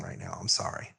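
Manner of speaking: soft, breathy apology under the breath; a quick hushed sorry; whispered aside. Real, not acted. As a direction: mutter it almost to yourself. Aside about [name].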